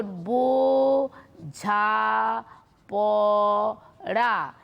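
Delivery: বোঝাপড়া as four long, separate open syllables. Shown as a demonstration. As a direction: stretch and hold each syllable of বোঝাপড়া. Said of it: "আলাদা, আলাদা".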